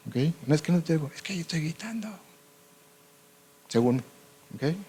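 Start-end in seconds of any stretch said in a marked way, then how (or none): 0.5-2.1 intento de grito sin voz, más agudo pero apagado; grito afónico. Shown as a demonstration. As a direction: grita y que casi no salga nada. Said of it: "O afónicos". (grito afónico sin voz)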